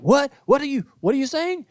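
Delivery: high-pitched, confused questioning; baffled producer. Retold or imitated. Producer answering the host over crowd noise, baffled. Producer unable to hear him.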